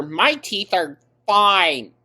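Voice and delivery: high, slow, sing-song mockery; mocking quote. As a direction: mimic her smugly, drawn out. Mocking Amber's teeth claims.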